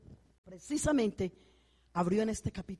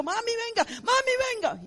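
much higher pleading call; urgent call. Retold or imitated. Daughter calling her mother over, urgent and high. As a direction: beg mami to come now.

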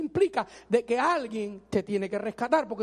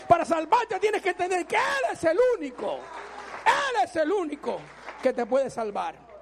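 much higher, shouted sermon climax; fiery preaching; 6 semitones higher, 4 dB louder than usual. Acted. Preacher warning people who try to help God, shouting. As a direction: preach it at the top of your voice.

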